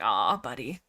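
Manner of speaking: low, soft aw of pity; gentle pitying sigh of words; tender sympathy. Real, not acted. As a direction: coo it to a sad friend. Sympathy for dying cat.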